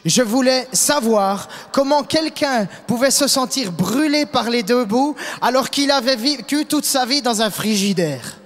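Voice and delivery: ordinary talk, nothing marked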